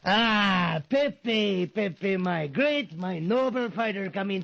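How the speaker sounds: gushing, high flattery; butter him up; fawning welcome. Acted. Manager flattering his boxer as he enters, gushing.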